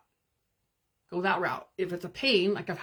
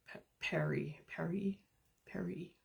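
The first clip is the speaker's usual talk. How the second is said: whispered, much softer name call; 9 dB quieter than usual. Real, not acted. Calling Perry quietly mid-livestream, hushed whisper. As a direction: call the name under your breath. Softly calling someone off-mic.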